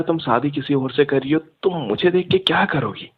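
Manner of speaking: ordinary talk, nothing marked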